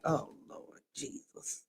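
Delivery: breathless whispered "Oh, Lord Jesus"; whispered exasperation. Retold or imitated. Mother whispering in dismay when her child says no kids. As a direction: sigh it out under your breath. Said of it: "I'd be like".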